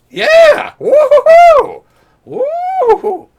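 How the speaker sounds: very high, loud, slow mock whoop with laughter; squeaky cheer. Mocking the good news, squeaky laughing whoop. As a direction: whoop like a giddy little creature.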